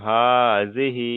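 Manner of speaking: হাযিহি sung slowly, long vowels; drawn-out hazihi. Shown as a demonstration. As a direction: stretch হাযিহি slowly like recitation. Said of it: "যেমন". (ধীরে টেনে হাযিহি)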